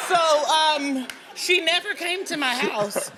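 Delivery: high, laughing delivery. Real, not acted. Laughing to the host that the ex never showed, laughing. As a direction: giggle as you say it. Laughing about the rival backing down.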